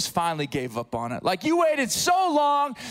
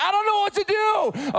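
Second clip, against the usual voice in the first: helpless panic; 10 semitones higher than usual. Retold or imitated. Husband to both wife's orders, high helpless panic. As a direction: throw up your hands in panic. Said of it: "I'm like".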